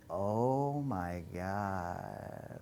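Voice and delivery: slow, dragged-out mortified gasp of words; slow mortified realization; stunned oh my God. Retold or imitated. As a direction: draw it out in horror at yourself. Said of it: "but I was like".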